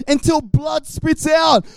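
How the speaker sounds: mock dramatic shout; hammed-up shout. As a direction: yell it out like a cartoon brawl. Acting out the fight.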